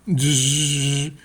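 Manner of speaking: playful drill noise between questions; dentist drill buzz. Retold or imitated. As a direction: mimic the whirr of a dentist's drill. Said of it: "lo trato de hacer como lo hacía el hermano".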